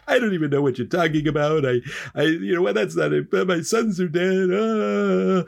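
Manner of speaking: high-pitched mock wailing; distraught father. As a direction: play a grieving father, hammed up. Bereaved father answers in distress.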